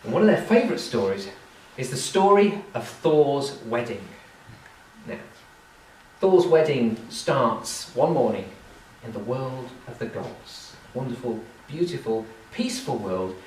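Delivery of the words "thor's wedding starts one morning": ordinary delivery, nothing marked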